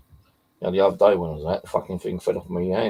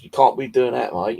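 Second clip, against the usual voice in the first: mock telling-off; 5 semitones higher, 5 dB louder than usual. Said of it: "I had to stop and tell him off".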